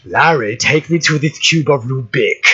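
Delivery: low villain impression breaking into laughter; Boris Karloff. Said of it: "in a Boris Karloff impression".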